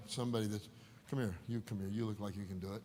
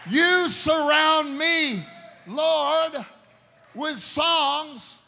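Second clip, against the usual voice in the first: loud, slow, ringing sermon voice; fiery preacher calling out; full-force preaching; 8 semitones higher, 12 dB louder, slower than usual. Acted. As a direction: preach it out to the whole church.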